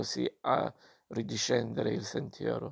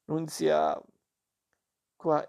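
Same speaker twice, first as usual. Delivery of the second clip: calling a name up the stairs, louder and higher; 4 semitones higher, 4 dB louder, slower than usual. Acted. Violante on the stairs calling her daughter, loud call. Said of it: "prese a salire le scale chiamando".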